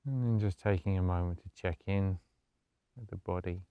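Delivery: soft, low-key meditation voice with long pauses; guided meditation. Acted. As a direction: gently guide them into stillness, hushed and unhurried.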